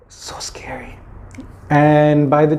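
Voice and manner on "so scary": hushed aside; whisper. A narrator's reading. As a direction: breathe it out, spooky. Narrator aside in story.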